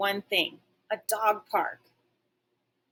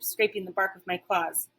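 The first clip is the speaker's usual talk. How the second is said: plain reading voice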